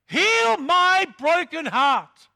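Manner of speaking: slow, loud, crying out heal my broken heart; anguished prayer. Pretend. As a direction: plead with God, stretching each word. Prayer cry repeated.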